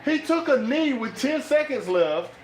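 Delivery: high-pitched, baffled protest; incredulous outburst. Real, not acted. Fan yelling at the coach's kneel-down, incredulous. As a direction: cry out in disbelief at the call.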